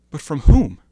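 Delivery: a sudden loud, pleading cry; anguished outcry. Acted. Man baffled by his own crime, crying out loud. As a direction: throw the question out in anguish.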